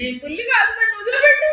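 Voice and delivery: high, tearful plea to let go; frightened cub pleading with the tiger; pleading cry. Acted. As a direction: beg to be released, voice rising.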